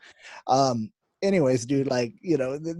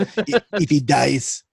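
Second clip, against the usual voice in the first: barbarian; 3 semitones higher, 6 dB louder, much slower than usual. Retold or imitated. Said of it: "Dolph Lundgren playing Ivan Drago".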